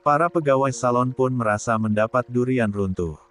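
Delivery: ordinary delivery, nothing marked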